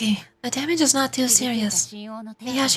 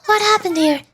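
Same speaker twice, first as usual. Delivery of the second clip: calling out; 7 semitones higher, 6 dB louder than usual. Acted. Calling to a stranger ahead.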